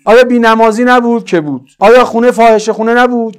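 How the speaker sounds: loud, high rhetorical questioning; full-force preaching. Real, not acted. Preacher challenging congregation about Imam Hussein's time, loud preaching. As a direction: fire the challenge at the whole congregation.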